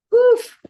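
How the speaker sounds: big, high-pitched exhaled "woof"; loud high woof. Real, not acted. Coach finishing the marketing material, loud relieved woof.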